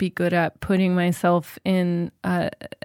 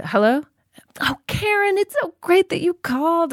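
much higher, bright sing-song phone greeting; mom's happy phone voice; 10 semitones higher than usual. Retold or imitated. Mom picking up the phone to Karen, suddenly much higher and sweetly happy. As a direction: switch to a sugary happy voice for the caller. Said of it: "all of a sudden her voice would be really happy".

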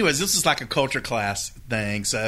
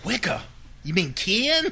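put-on puzzled old man; 7 semitones higher, slower than usual. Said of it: "And he goes".

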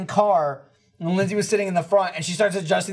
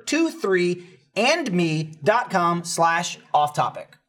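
slow, spelled out; much slower than usual. Shown as a demonstration. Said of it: "the number".

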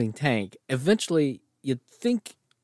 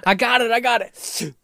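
confident soldier; 10 semitones higher than usual. Retold or imitated. Acting soldier chasing ball.

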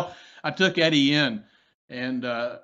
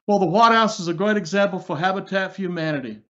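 higher-pitched Southern president impression; Jimmy Carter; 6 semitones higher, 3 dB louder than usual. Host showing off for a comedian guest, Carter impression. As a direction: do a high-voiced presidential impersonation. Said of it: "let me hear your Carter".

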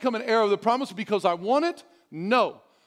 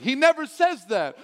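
ordinary talk

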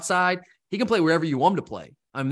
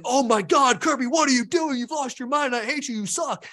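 hammed-up outraged fan, pitched way up; mock fan freakout; 9 semitones higher than usual. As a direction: play a panicking fan yelling at the coach. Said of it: "Everyone's freaking out and saying".

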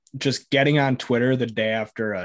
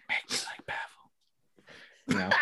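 slow, hushed pretend whisper; mock whisper; 5 semitones lower, 10 dB quieter, much slower than usual. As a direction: whisper it slowly as a playful plea. Acting out a player's plea.

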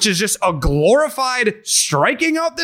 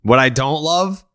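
ordinary talk

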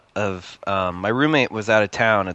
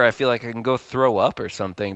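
ordinary talk

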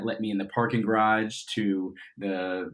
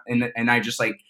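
ordinary talk, nothing marked

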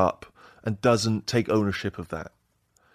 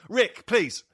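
high-pitched plea at usual volume; exasperated teacher; 10 semitones higher than usual. Acted. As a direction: act the teacher reining in a joker. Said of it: "be the teacher".

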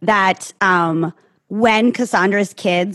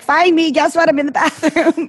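from another room; 8 semitones higher than usual. Retold or imitated. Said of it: "She's like".

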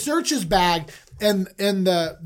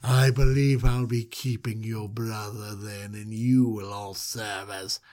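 much lower, slow menacing questioning; creepy ruler voice; 8 semitones lower, 6 dB quieter, slower than usual. Retold or imitated. Joseph questioning the brothers, low and slow creepy voice. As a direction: loom over them, slow and low like a creep. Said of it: "And he's like".

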